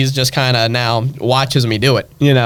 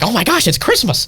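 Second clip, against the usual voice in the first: higher, mock elfin cheer; put-on excitement; 9 semitones higher than usual. Performer supposed to be the cheerful elf, put-on higher excitement. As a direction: fake giddy Christmas glee, pitched up. Acts the elf's expected Christmas cheer.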